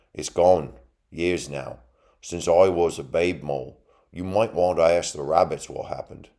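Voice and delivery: lightly rustic talking mole; mole character. A narrator's reading. Mole's line in narrated story.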